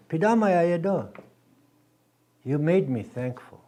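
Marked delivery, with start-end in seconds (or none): 0.1-1.1 Pidamayaye do, higher, with a falling end; Dakota male thanks. Shown as a demonstration. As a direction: model Pidamayaye do with stress on Pidamayaye. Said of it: "The male will say".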